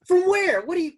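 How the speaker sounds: high, loud, taken-aback question; startled reaction. Retold or imitated. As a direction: retell being caught off guard, voice jumping up. Acts out being recognized by stranger.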